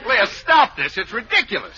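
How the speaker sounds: high-pitched, fed-up protest; exasperated. Acted. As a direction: order your wife to stop the act. Husband begs her to stop.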